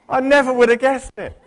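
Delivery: high, loud ironic exclamation; arch, exaggerated surprise; mock disbelief. Real, not acted. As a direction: play up the sarcastic astonishment. Ironic remark on political news.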